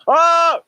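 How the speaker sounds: loud, high cry of alarm; sudden scream. Retold or imitated. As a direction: cry out as the plane drops. Acts out the plane dropping.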